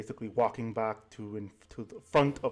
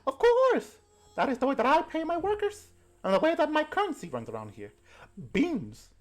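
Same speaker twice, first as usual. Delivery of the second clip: put-on old granny voice; 11 semitones higher than usual. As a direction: coo like a doting grandma pinching cheeks. Granny explains bean currency.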